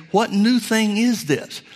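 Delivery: high, slow, mock-amazed question; shocked crowd. Preacher voicing the astonished synagogue crowd, high shocked question. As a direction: let the crowd's shock lift the line way up. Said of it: "would they be shocked and say".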